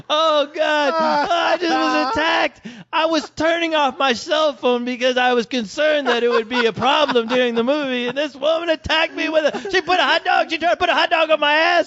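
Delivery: high, shouted mock distress; hammy fake victim. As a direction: wail like you were assaulted.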